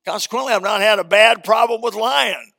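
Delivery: loud, high preaching. Real, not acted. Preacher to congregation, loud. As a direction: drive home the lesson.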